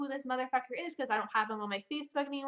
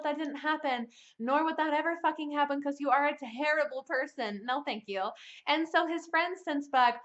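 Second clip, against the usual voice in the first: ordinary talk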